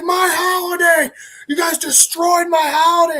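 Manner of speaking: high, strained villain yell; acted outraged shout. Comic villain to heroes who wrecked things, acted outraged shout. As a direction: wail it as a hammed-up villain.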